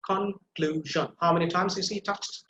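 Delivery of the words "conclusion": drawn out, syllables pulled apart; conclusion, slow and spaced. Shown as a demonstration. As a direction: sound out each syllable slowly. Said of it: "So you see".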